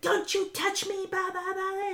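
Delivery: high mock snap, imitating Dr. Deb; snapping. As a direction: snap at the guy in a shrill imitation. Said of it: "snapped at him".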